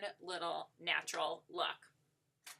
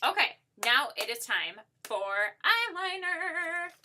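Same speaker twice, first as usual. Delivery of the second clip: higher, louder and slow, like a big reveal; excited announcement; 8 semitones higher, 7 dB louder than usual. Real, not acted. Tutorial host announcing eyeliner to viewers, excitedly. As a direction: make the eyeliner step sound like a treat. Announcing the next makeup step.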